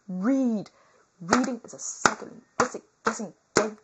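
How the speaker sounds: ranting. Pretend. Loud ranting about reading.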